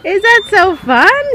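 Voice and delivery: long, high, loud cheers with laughter; gleeful cheering. Real, not acted. Mom cheers toddler on the swing, gleeful and high.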